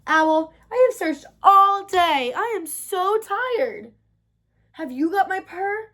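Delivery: high, drawn-out weary whine; tired little kitten pleading; sleepy, tired kitten. A narrator's reading. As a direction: complain slowly, worn out from searching. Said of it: "stumbled sleepily over to Owl's tree and said".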